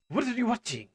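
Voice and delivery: toned-down demand. Acted. Repeats the police question, toned down.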